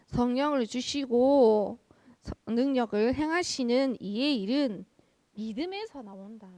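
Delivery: loud, slow proclamation of a verse; Paul's words proclaimed to a congregation; scripture declamation. Acted. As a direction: declaim it loudly, stretching the phrase endings.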